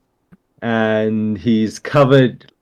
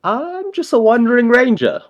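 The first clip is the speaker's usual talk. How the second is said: innocent claim; 13 semitones higher than usual. Acted. Rogue lies about who he is.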